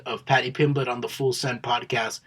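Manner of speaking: ordinary talk, nothing marked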